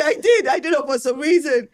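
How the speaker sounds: high, loud, through laughter; laughing admission. Real, not acted. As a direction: own up while cracking up. Laughing as she admits it.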